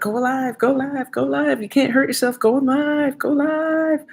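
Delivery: high pushy friend voice. Retold or imitated. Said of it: "She's like".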